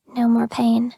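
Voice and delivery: usual speaking voice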